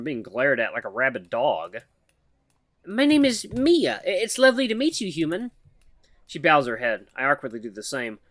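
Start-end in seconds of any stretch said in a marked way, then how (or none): 2.8-5.5 higher, halting nervous voice of a shy mouse; timid mouse woman. Acted. Shy mouse woman nervously calls to the human, halting and high. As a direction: stammer it out like a timid mouse calling for attention.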